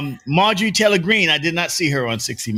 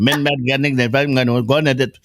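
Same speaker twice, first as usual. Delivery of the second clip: put-on Indian accent; comic impression with an Indian accent; 3 semitones lower than usual. Joking as the Indian dude from the FBI, Indian accent. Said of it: "When I do my Indian accent".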